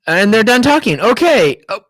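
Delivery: usual speaking voice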